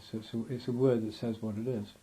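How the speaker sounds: normal talking voice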